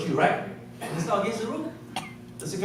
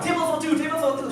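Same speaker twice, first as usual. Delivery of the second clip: eager calling; 9 semitones higher, faster than usual. Retold or imitated. Calling out for a table.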